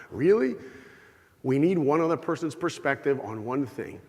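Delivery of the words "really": incredulous really. Real, not acted. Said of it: "mocking the movie examples".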